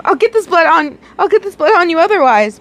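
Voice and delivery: high and frantic warning; panicked, pulling away; hysterical. A narrator's reading. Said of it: "shouted out hysterically".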